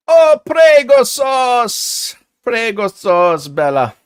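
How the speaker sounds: slow, loud, hammy Italian "prego sauce"; mock Italian. Streamer reading a chat name in mock Italian. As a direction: belt it like an Italian chef.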